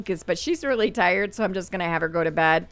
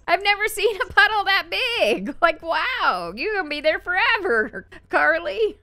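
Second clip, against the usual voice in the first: higher, playfully amazed; mock amazement; 9 semitones higher than usual. Real, not acted. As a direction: marvel at the giant puddle.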